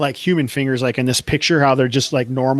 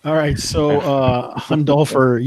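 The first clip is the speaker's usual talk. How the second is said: usual speaking voice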